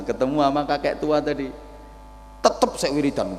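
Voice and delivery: ordinary talk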